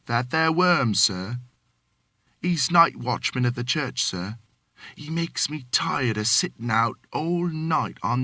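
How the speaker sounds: lightly voiced Cockney manservant, deferential; a hint of London Cockney, polite to his master; Cockney servant. Thomas answering his employer, light Cockney. Thomas complains about watchman.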